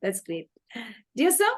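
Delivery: normal talking voice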